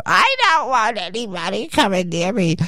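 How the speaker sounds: high, put-on diva voice. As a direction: imitate her, high and fussy. Quoting [name] on show.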